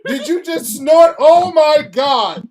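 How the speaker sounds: loud, high disbelief; shocked shout. Real, not acted. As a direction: blurt it out amazed. Reacting to a snort.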